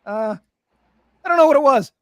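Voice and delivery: ordinary talk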